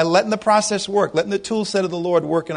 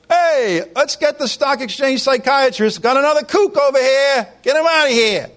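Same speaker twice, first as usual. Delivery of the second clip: mocking trader; 7 semitones higher than usual. Traders mocking a broke man.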